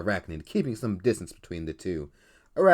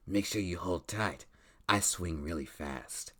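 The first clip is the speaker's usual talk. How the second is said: arachnid voice; 2 semitones lower, 8 dB quieter than usual. Acted. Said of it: "That's my arachnid voice".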